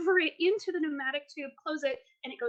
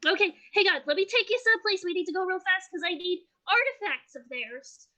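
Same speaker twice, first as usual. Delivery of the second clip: higher, urgent character voice; hurried game character; 5 semitones higher, 3 dB louder than usual. Acted. Game master as Depp hurrying the party, urgent. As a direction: voice a small helper hurrying everyone along.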